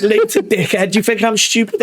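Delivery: a light smug taunt; mock taunt. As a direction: gloat at him, teasing. Retold taunt after prank.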